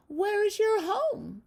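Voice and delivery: high, slow, loud lamb; curious lamb, high and slow; little lamb voice. Acted. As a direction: ask it wide-eyed like a lamb. Lamb voiced in a read-aloud.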